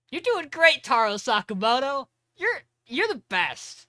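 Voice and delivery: high, loud gushing cheer; gushing Lou. Retold or imitated. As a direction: cheer him on like a smitten fan. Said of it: "and then Lou goes".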